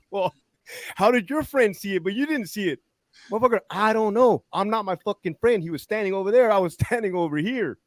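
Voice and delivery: high-pitched mock back-and-forth quarrel; hammed-up indignant protest; exasperated arguing. Acted investigator and officer arguing.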